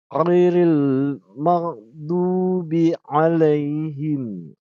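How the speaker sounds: loud, very slow Khairil maghdubi alaihim; slow recitation. Shown as a demonstration. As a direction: recite it slowly and fully, throaty kh and gh. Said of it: "Khair ini seperti G dia".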